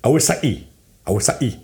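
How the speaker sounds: practice repeats in a mock Newcastle accent. Shown as a demonstration. Coaching a friend in the trick, Newcastle accent. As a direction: drill Kawasaki in a Geordie Newcastle accent. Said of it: "to do a Newcastle accent".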